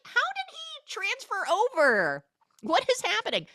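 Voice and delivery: very high squeal of disbelief; incredulous. Retold or imitated. Recapper baffled by the school transfer, squealed incredulity. Own exaggerated incredulous reaction.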